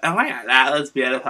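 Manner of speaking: mock Valley girl gush; Nikki Bella. Fan contrasting Nikki with Eva, mock Valley girl impression. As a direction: lightly send up a Cali mean girl. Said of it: "Nikki Bella's the".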